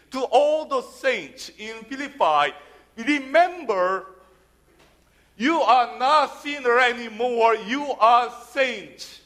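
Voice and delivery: usual speaking voice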